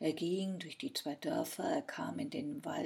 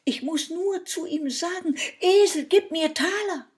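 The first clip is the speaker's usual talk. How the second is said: high, loud, excited boast; 9 semitones higher, 13 dB louder than usual. A narrator's reading. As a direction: proudly show off the trick, voice raised. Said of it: "ganz aufgeregt". (ganz aufgeregt, laut und hoch)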